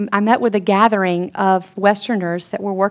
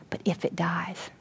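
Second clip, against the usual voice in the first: hushed, softer whisper; reverent whisper; 3 semitones lower, 14 dB quieter than usual. Acted. Preacher quoting Jesus, whispering. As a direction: drop to a whisper on the key words.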